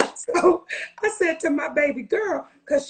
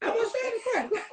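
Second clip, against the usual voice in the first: laughing; 10 semitones higher, 5 dB quieter, much faster than usual. Real, not acted. Repeat, higher with laugh.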